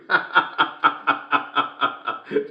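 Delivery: staccato run of ha-has; long chuckle. Acted. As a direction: keep chuckling in a steady rattle. Laughs at own joke.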